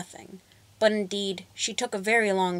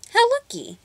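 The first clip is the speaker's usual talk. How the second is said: put-on girl's voice; 6 dB louder than usual. A narrator's reading. Natasha voiced high in tale.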